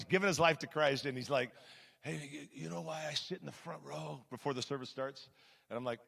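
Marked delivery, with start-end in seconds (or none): 2.0-5.3 very quiet, intimidating mobster voice; Glenn. Acted. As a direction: play the Godfather heavy, almost under the breath. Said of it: "he talks like this".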